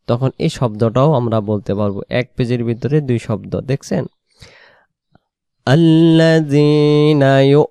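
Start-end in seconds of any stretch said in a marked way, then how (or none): 5.7-7.6 আল্লাযীনা ইউ stretched slowly and louder; slow recitation. Shown as a demonstration. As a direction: draw out আল্লাযীনা ইউ. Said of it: "teacher demonstrates reading". (টেনে তিলাওয়াত)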